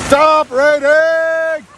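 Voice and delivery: drawn-out high yell at the rain; frustrated shout. Real, not acted. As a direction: bellow it slowly at the sky. Yelling at the rain.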